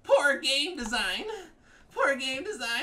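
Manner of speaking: very high, loud, drawn-out whine; mock whining. Player mimicking rival whining about the game, high loud whine. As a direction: imitate a whining sore loser at top pitch. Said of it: "he keeps fucking whining".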